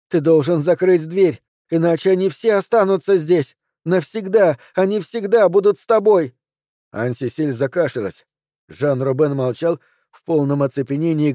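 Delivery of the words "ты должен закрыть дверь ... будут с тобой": loud, high, desperate warning; urgent shout. A narrator's reading. Anne-Cécile shouts at Bastien to close the door. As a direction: shout the warning with growing panic.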